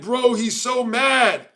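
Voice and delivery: high, very drawn-out mimicry; mock taunting. Streamer quoting Khan's jab, drawn-out mock taunting. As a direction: stretch it out slowly like a teasing chatter. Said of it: "Khan said".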